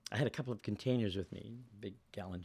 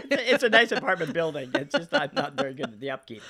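laughing; 5 semitones higher, 9 dB louder than usual. Real, not acted. Laughing at her messy apartment.

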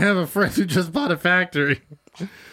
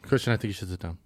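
breaking bad news; 9 semitones lower, 8 dB quieter than usual. Improv scene, breaking news again.

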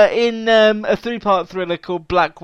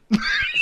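burst of laughter. Real, not acted. Laughing after joke.

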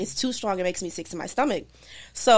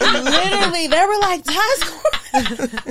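laughing hard while telling it; breaking up with laughter mid-story; 9 semitones higher, 6 dB louder, slower than usual. Real, not acted. Laughing while telling friends about mom's call.

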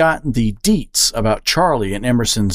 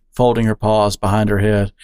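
usual speaking voice

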